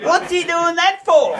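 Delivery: high, slow, baffled mother; puzzled mum. Retold or imitated. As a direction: play the innocent puzzled mum. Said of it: "Mum looks at me and said".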